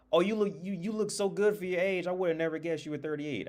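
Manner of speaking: high-pitched imitation of the waitress; gushing compliment. Waitress complimenting a customer's looks, high gushing imitation.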